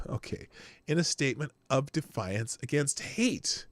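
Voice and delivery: normal talking voice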